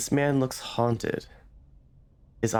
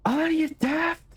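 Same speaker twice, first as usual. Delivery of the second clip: shouting. Acted. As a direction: explode at the intruder. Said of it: "he begins shouting".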